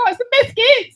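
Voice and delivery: squished-face, very high Australian accent. Shown as a demonstration. Comic turning British into Australian, squished-face accent. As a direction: scrunch the nose and squeak it in Australian. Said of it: "you just squish your face out".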